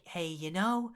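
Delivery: lightly mocking imitation of a squeaky actor; soft put-on voice lifting at the ends; a hint of a high, mimicked Joker; high-pitched voice. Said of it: "his voice was really high-pitched".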